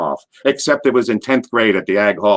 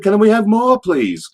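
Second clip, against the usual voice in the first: high-pitched pleading urchin; quick begging bit; 11 semitones higher than usual. Retold or imitated. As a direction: beg like a hungry orphan. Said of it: "I'm doing a quick".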